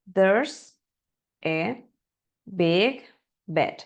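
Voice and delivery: choppy word by word. Shown as a demonstration. Teacher reading each word separately like a beginner. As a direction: read it word by word, like a robot. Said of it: "Que también es un error bastante común de los alumnos que recién comienzan".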